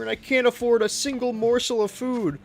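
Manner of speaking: high, pitiful begging voice; whiny beggar voice. Pretend. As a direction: plead like a starving beggar. Mock begging poor streamer.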